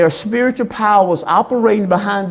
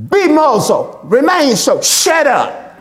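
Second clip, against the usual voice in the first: stern rebuke; slower than usual. Shown as a demonstration. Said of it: "So we could say it like this".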